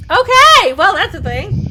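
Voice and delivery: loud, high startled outburst; shocked. Real, not acted. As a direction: caught off guard, blurting it out loud. GM shocked by the neck bite.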